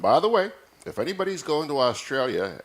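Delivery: bursts out high and loud; excited. Real, not acted. Host eagerly begs listeners for a koala, excited. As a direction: suddenly gush with excitement.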